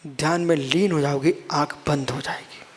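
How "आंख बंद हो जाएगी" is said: hushed whisper. Acted. Preacher to listeners, showing eyes closing in meditation, whispered. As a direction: drop to a soft breathy whisper.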